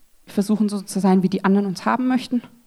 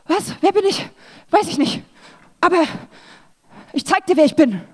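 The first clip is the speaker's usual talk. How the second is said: higher, bewildered then combative; mock confused defiance; 6 semitones higher than usual. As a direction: act the lost woman lashing out. Voicing a confused, defensive woman.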